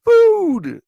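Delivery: high, loud squeal of a greedy squirrel; excited squirrel cry. Retold or imitated. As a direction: squeal it like a starving squirrel spotting bread. Squirrels rushing to thrown food.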